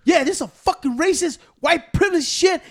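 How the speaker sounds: high, loud indignant complaint; imitated angry accusation. Customer accusing staff in front of everyone, imitated loud outrage. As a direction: act out a man ranting at the store.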